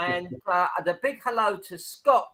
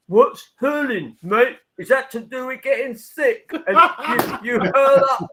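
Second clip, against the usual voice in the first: mock Cockney bluster, pitched up and louder; fake Cockney accent; 8 semitones higher, 6 dB louder than usual. Retelling a Cockney fan questioning his shirt, fake Cockney accent. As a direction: play a Cockney terrace lad, loud and cheeky, Cockney accent. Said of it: "a fake Cockney accent".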